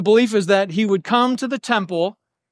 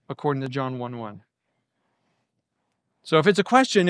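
normal talking voice

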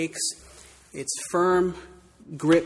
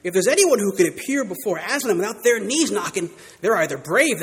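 higher, louder homely warning; Missus Beaver, knowing; 4 semitones higher, 6 dB louder than usual. Acted. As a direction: warn the children plainly. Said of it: "said Missus Beaver".

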